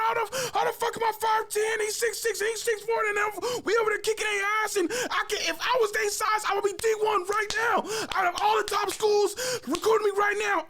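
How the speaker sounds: very high-pitched, shouted cursing rant; re-enacted angry meltdown. Player cursing out his practice over being undersized, losing it. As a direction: lose it completely, retelling a furious outburst. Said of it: "So I lost my shit".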